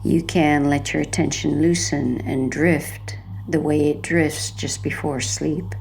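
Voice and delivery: normal talking voice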